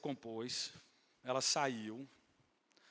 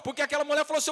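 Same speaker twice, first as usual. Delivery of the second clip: full-force preaching; 11 semitones higher, 10 dB louder, much faster than usual. Real, not acted. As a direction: shout each phrase to the whole congregation, stretching the endings. (pregação inflamada aos brados)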